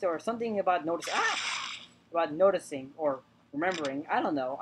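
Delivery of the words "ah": sudden high cry cutting off speech; startled yelp. Real, not acted. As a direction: let out a quick startled yelp. Startled, breaks off the sentence.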